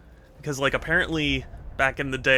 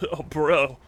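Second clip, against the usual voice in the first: laid-back California surfer bro, slightly higher; Californian surfer dude; 3 semitones higher than usual. Acted. Streamer showing chat the accent they love, Californian surfer dude. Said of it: "I love California surfer, surfer dude".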